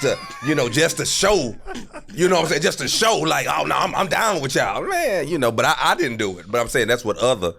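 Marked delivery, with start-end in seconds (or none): 3.5-4.8 hammed-up plea to be accepted, pitched high; eager to fit in. Preacher's kid insisting to friends he's one of them, eager and high. Proving he's cool to peers.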